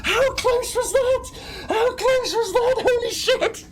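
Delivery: very high, loud laughing shout; ecstatic disbelief. Real, not acted. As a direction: shriek with relief and glee. Narrow escape, laughing.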